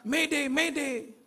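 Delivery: high, slow drawn-out distress call; Mayday stretched out and pitched high; yelling mayday. Retold or imitated. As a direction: call for help over the radio. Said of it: "dia teriak-teriak".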